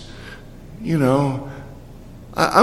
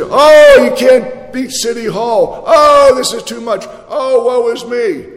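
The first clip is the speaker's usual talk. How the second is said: whining defeatist; 6 semitones higher, 6 dB louder than usual. Retold or imitated. Mocking defeatist believer.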